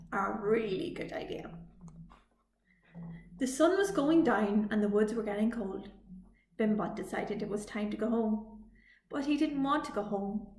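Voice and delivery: plain reading voice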